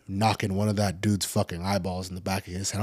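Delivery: ordinary talk, nothing marked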